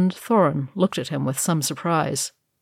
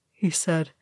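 usual speaking voice

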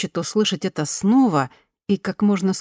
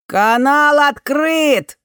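higher, much louder, slow drawn-out yell; shouting; 10 semitones higher, 6 dB louder, much slower than usual. A narrator's reading. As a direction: bellow it across the room from a chair. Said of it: "заорал он". (громкий протяжный крик)

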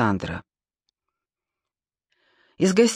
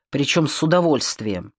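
ordinary delivery, nothing marked

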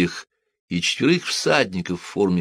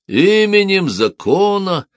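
slow, much higher commanding shout; 12 semitones higher, 6 dB louder, slower than usual. A narrator's reading. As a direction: bellow it at the gate like an officer. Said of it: "раздался крик снаружи".

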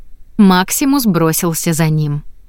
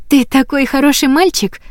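praising; 7 semitones higher than usual. A narrator's reading. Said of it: "похвалила она".

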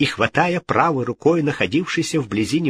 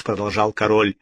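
ordinary delivery

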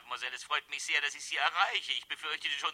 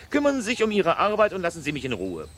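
louder, curt dismissal of the gossip; sharp rebuke; 3 semitones higher, 7 dB louder than usual. Acted. Boss snapping at gossiping housekeeper Georgette, louder and sharp. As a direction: slap her down and send her back to work. Employer scolding nosy housekeeper.

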